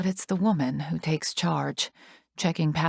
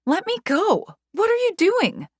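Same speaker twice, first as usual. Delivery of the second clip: high, louder panicked yell; frantic yell; 4 dB louder than usual. A narrator's reading. Yelling at Joker while held back, frantic. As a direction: struggle against the hands holding you.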